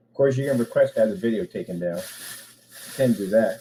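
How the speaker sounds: normal talking voice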